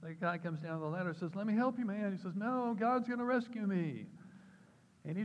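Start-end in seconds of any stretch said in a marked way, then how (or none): 1.4-2.1 high, loud offer of help; raised-voice storybook rescuer; acted calling out. 2.4-4.1 high, loud, drawn-out refusal; hammed-up drowning man calling back; acted stubborn refusal. Drowning man refuses third rescue.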